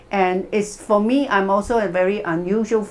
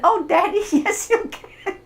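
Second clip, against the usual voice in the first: put-on high, laughing child's voice; a giggly kid egging his dad on; ten-year-old son; 13 semitones higher than usual. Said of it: "And his ten-year-old son".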